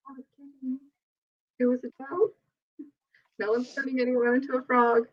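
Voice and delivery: high, soft flustered backpedal, lightly imitated. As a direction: squeak out a nervous take-back. Interviewer nervously backtracking, imitated.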